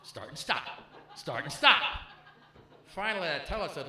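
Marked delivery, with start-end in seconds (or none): 0.0-1.8 mocking repetition. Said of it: "every time we made love".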